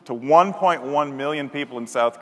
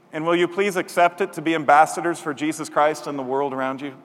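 ordinary delivery

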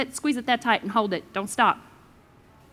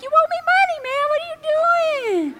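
much higher, outraged at the debtor; indignant demand; 3 dB louder than usual. Retold or imitated. Imagined lender yelling at the borrower in his new car, high and indignant. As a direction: squeal it like you spot your money driving by. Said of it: "You're like".

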